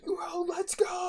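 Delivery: high, drawn-out celebratory yell; hammed-up victory cry; mock cheering. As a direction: cheer as if set free, stretching the words. Mock cheer at presentation ending.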